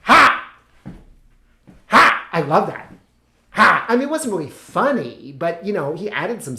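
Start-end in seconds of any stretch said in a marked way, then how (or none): 0.0-2.3 loud, high, short "ha"; imitated dice-throw shout. Friend rolling dice on stage, loud high shout. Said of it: "he was like". 3.5-3.9 loud, high single "ha"; one big punched ha; imitated dice-throw shout. Friend rolling craps on stage, loud shout. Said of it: "he was like".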